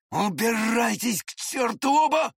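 high screamed dismissal; Lambert's furious yell. A narrator's reading. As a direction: yell at both to get out. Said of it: "завопил Ламберт".

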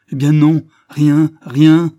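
loud, high outburst on each refusal; reproches furieux. A narrator's reading. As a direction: fling out each word in a high, loud fury. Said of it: "il éclata en reproches furieux". (reproches furieux, voix haute et forte)

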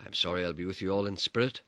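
usual speaking voice

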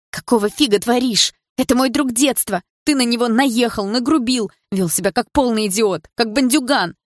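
tearing into him for his rudeness; angry outburst. A narrator's reading. Girl furiously scolds Bars for insulting her friend, loud.

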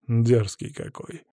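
slow, cool disdain; drawn out with understated coldness; cold reply. A narrator's reading. Masked captor answers the insolent prisoner, slow and cold. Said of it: "холодно ответил человек в маске".

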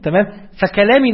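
ordinary talk

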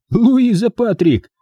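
slow, high-pitched taunt; taunting presentation. A narrator's reading. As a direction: draw the name out high, challenging her. Mike mockingly presents her to her.

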